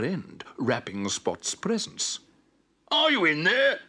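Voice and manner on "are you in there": high, loud call; shouting. A narrator's reading. Spot calling into the dark shed, shouting. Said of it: "he shouted".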